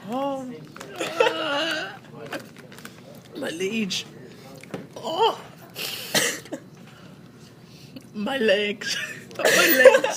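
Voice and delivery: high-pitched, wailing mock sobs; crying. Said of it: "in this full-on cry".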